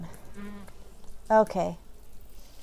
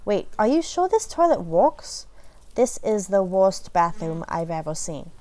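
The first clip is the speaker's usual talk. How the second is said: ordinary talk, nothing marked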